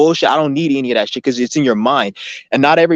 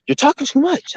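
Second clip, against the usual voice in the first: much higher mock of mom scolding; mom complaining; 14 semitones higher than usual. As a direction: squeak out mom's nagging. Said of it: "she was like".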